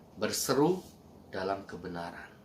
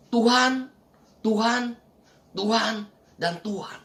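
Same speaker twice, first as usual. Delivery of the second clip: hammed-up imitation of believers crying to God; mock calling out; 9 semitones higher, slower than usual. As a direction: call "Tuhan" like a pleading crowd, then let it fade.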